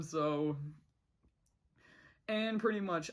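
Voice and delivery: ordinary delivery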